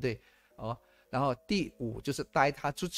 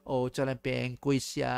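ordinary talk

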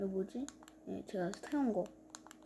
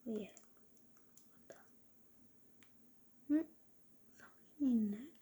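whispering. Real, not acted. Whispering to self while playing.

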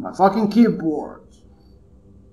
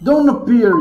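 sudden shout; 3 semitones higher, 7 dB louder than usual. Real, not acted. Yelling at game jump scare.

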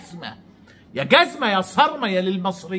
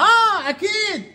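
sarcastic; 12 semitones higher than usual. Real, not acted. Sarcastic agreement, very high.

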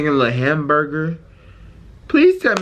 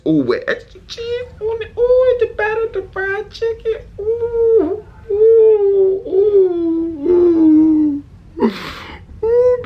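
very high, wailing oohs swelling louder; food swoon; 14 semitones higher, 4 dB louder, much slower than usual. Real, not acted. Reacting to fried chicken and extra cheese, swooning. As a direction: moan in high-pitched rapture over the food.

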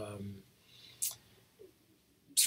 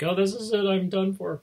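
higher-pitched, hammed-up doom; mock despair; 6 semitones higher, 4 dB louder than usual. Sufferer in a back spasm, mock despair. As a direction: wail lightly as if the end has come.

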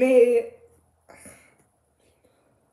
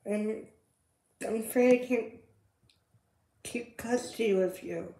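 quiet sad confession; 3 semitones lower, 7 dB quieter than usual. Acted. Mother admits losing custody.

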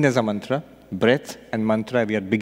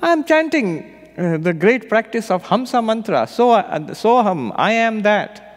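much higher, louder indignant explanation; indignant king; 8 semitones higher, 4 dB louder than usual. Retold or imitated. King explaining his mantra to the sage, high indignant. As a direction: defend the practice with pride. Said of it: "king explains his practice".

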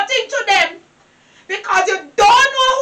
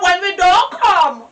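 normal talking voice

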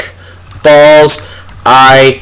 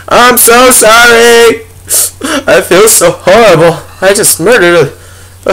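mock apology; 6 semitones higher than usual. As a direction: draw it out like fake remorse. Apologizing to the killed animal.

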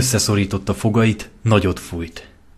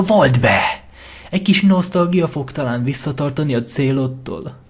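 sly, pushy voice, raised and louder; scheming tempter; 3 semitones higher, 5 dB louder than usual. Acted. Lentó talks Lyra into killing her friend, sly and pushy. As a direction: coax her toward the knife.

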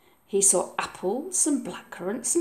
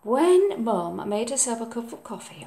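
plain reading voice

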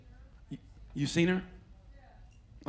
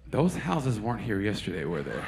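bewildered neighbour; 11 semitones lower than usual. Retold or imitated. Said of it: "You come out of your house like".

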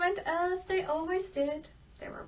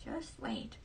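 ordinary delivery, nothing marked

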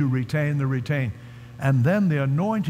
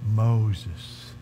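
lightly voiced dying man, halting with long pauses; a hint of a frail man, lower, words spaced out; dying sick man; 3 semitones lower, slower than usual. Sick man on his bed to the visiting preacher, lightly voiced, halting. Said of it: "the sick man was now a bag of bones".

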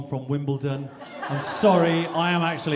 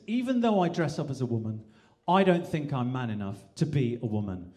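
ordinary talk, nothing marked

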